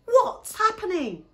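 high, loud acted bewilderment; startled question. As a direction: demand it loudly, taken aback. Dad walks into the racket.

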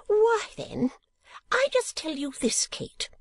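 high, drawn-out fussy matron; a scandalised old lady, very high; Mrs Nickleby. Acted.

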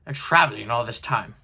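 usual speaking voice

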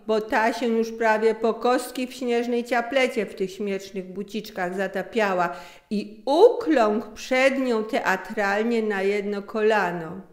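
ordinary delivery

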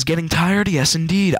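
ordinary talk

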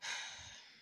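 soft breathy sigh; shaky sigh. Real, not acted. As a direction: let out a weary sigh. Tired new mother in hospital.

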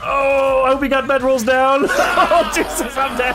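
high laughing yelp; laughing panic. Real, not acted. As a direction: laugh through sudden death. Dying in game, laughing.

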